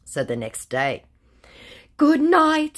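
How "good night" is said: high, louder sing-song good night; mock sweet goodnight. Scammer messaging at two in the morning, mock cooing. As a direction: coo it like a fake suitor.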